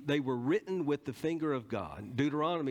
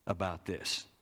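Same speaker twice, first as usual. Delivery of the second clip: scripture reading; 5 semitones higher, slower than usual. Acted. Preacher reads verse aloud.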